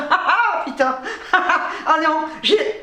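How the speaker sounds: laughing through the words, slowed; nervous giggles breaking the swear; real nervous laughter. Real, not acted. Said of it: "Je ris, c'est nerveux là".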